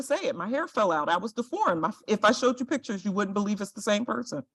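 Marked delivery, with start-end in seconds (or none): none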